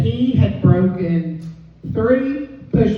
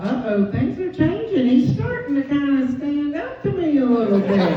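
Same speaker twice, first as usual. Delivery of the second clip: a touch higher, mock worried; worried inner voice; 6 semitones higher than usual. Wife thinking husband is changing, a hint of mock worry. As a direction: think it with mild alarm. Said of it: "And I thought".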